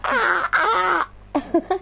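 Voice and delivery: high mock crow caw. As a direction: imitate a crow cawing. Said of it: "how they just go like".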